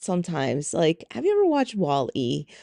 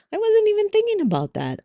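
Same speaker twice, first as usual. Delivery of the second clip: much higher, fast protest; exasperated protest; 14 semitones higher, faster than usual. Retold or imitated. Quoting herself to the AI, high exasperated protest. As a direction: squeal it out in disbelief.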